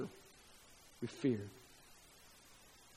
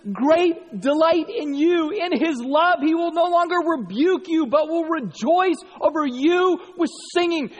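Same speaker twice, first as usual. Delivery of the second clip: louder, higher, slow and preached; fervent reading; 10 semitones higher, 6 dB louder than usual. Real, not acted. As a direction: proclaim it with rising joy. Rereads verse with fervour.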